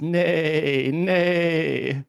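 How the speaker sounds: high, loud horse-neigh pun on "nay"; whinny the word like a horse, drawn out. Acted. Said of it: "All I'm hearing is".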